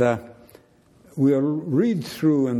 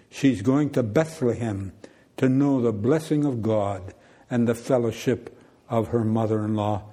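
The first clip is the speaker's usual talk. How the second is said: ordinary talk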